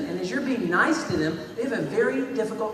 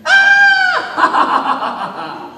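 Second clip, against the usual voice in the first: much higher, loud laughing yelp; high laughing shout; 11 dB louder than usual. Acted. Victim shrieks with laughter at the bully. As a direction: burst out with a high squealing laugh.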